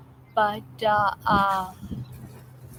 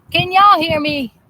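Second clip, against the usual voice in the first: southern twang; 5 semitones higher, 7 dB louder than usual. Real, not acted. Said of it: "if I'm talking like that".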